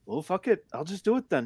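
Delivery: light imitation of a Canadian accent. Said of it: "in his Canadian accent".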